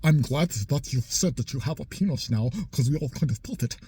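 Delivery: lightly voiced smug macho man; a hint of a gloating tough guy; mock Tate. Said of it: "says something about her, like".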